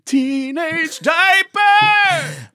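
drawn-out, very high shout; stretched, high-pitched playground taunt; mock taunting yell. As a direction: yell it slowly like a schoolyard jeer. Playing schoolkids yelling a taunt.

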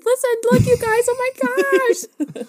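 high, loud excited squeal; retold excited squeal. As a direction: gush like you just heard big news. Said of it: "I was so excited".

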